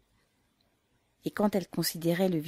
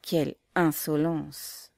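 ordinary delivery

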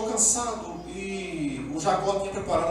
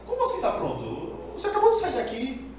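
higher, bewildered question; astonished father; 10 semitones higher than usual. Retold or imitated. Isaac confused by a second son, higher. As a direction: sound baffled and high. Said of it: "Isaac falou".

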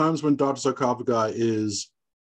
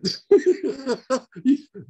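laugh; 12 semitones higher than usual. Real, not acted. Laughing at own story.

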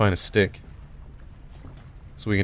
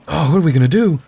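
panicked; 8 semitones higher, 8 dB louder than usual. Real, not acted. Panics at stealth section.

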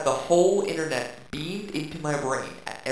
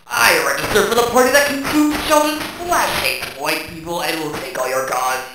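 evil counterpart; 7 dB louder than usual. Acted. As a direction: rant like a paranoid zealot. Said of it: "my evil counterpart with my opposite views".

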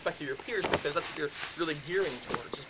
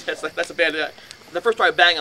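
usual speaking voice

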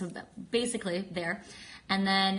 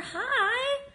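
squealed, much higher and louder hi; excited greeting; 6 dB louder than usual. Real, not acted. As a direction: burst out with a delighted hi to a friend.

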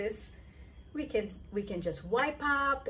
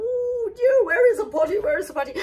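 higher, loud worried question; frantic searching; 11 semitones higher, 7 dB louder, much faster than usual. Acted. The general asking for the potty, frantic. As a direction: search around in a hurry.

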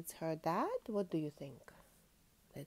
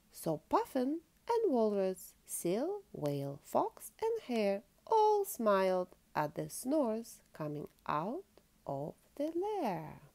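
lively storybook reading aloud; reading to children; 7 dB louder, slower than usual. Acted. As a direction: read it like a bedtime picture book. Reads picture book to child.

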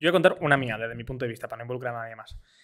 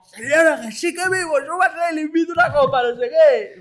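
high mock drunk voice; cheeky drunken appeal to the lady; playing his drunk self, pitched up; drunk pleading; 10 semitones higher, 4 dB louder than usual. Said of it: "Era como".

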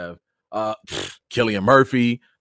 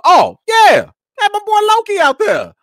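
much higher and louder, thrilled to spot him; excited shout; 12 dB louder than usual. Real, not acted. Fan spotting Loki at the awards, thrilled shout.

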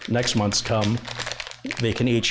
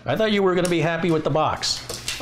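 high coaxing voice to an eager dog; talking to the dog; 5 semitones higher than usual. Real, not acted. Owner holds off the eager dog, high and coaxing. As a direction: hold the dog back, pitched up and playful.

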